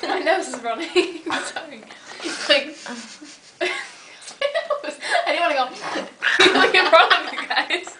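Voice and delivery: snotty laughing, nose running; laughing so hard you tear up; crying with laughter. Real, not acted. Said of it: "Sorry, I'm crying".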